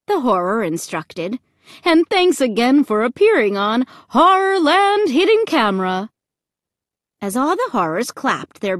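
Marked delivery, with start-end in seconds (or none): 1.8-6.1 high, loud show sign-off; cheery MC. A narrator's reading. MC thanking the family for appearing, cheery. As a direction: sign off like a game-show host.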